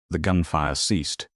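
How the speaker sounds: ordinary delivery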